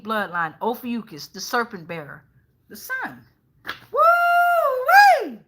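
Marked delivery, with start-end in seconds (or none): 2.7-5.4 high whooping cheer; excited shout. Real, not acted. Reader thrilled the sun card came out, whooping shout. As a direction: let out a big high woo-wee.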